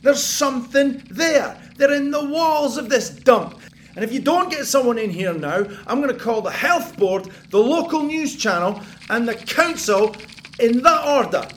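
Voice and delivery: loud, hard threatening tirade; furious threat. Retold or imitated. Tenant threatens landlord with health board, furious shouting. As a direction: lay into the landlord.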